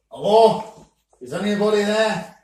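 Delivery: loud shop greeting; calling out. Acted. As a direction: call out for whoever's there.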